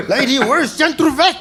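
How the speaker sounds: loud, high shouted question; acted-out frustrated shouting at a stranger; frantic demand. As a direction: yell desperately for directions. Yells at woman he has grabbed.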